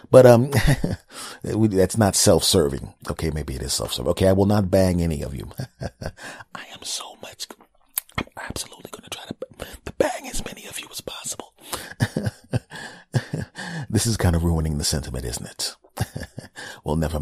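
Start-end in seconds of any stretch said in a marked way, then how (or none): 5.8-11.5 hushed, voiceless confession; mock intimate whisper. Host whispering a joke promise to fans, mock intimate whisper.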